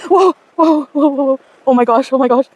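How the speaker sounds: high panicked whoas, losing footing; screaming while falling. Real, not acted. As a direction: shriek in real fear on a slope. Sliding down steep slope, scared.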